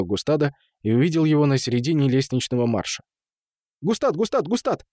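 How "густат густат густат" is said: high, rapid-fire name calling; high excited calling. A narrator's reading. Темул spots his beloved Густад, high excited calls. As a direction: call the name eagerly, much higher. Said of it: "высокий голос, бурно тараторивший".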